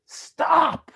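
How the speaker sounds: sudden high yelled stop, half acted; a killer yells at a monk to halt; retold scream. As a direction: cry out stop like a desperate killer. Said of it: "he just screams at the Buddha".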